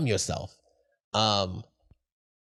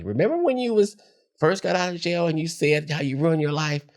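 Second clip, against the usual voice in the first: put-on high, teasing older-sister voice; imitating big sister; 9 semitones higher, 3 dB louder than usual. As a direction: mimic her needling him fondly. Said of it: "she'll remind me".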